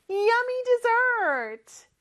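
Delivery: put-on high, slow, delighted child voice; excited child. Teacher plays kids cheering for tasty dessert. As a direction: sound like a kid eyeing cake. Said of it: "우리 아이들이".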